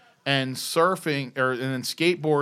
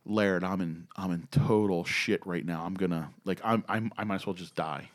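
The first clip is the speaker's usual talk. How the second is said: low, subdued confession of trouble; retold despair; 9 semitones lower, 9 dB quieter than usual. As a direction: imitate a friend glumly confiding he's doomed. Caller confides he is in deep trouble.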